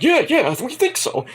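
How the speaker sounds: high mock chorus voice; lightly high encouraging reply; class encouraging. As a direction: answer like an eager class. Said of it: "So like".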